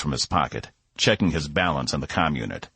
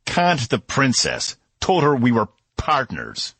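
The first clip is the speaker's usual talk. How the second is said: slow, higher angry yell; 8 semitones higher, 3 dB louder, slower than usual. A narrator's reading. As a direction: bite out each word in fury. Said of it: "Cass yelled".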